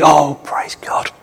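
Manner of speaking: slow, breathed-out whisper of praise; relieved whisper. Acted. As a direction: whisper it slowly, as a private thought of relief. Joshua's relieved thought on God arriving.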